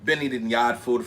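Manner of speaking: Jamaican patois. Pretend. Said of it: "Tell me how my brother sounds".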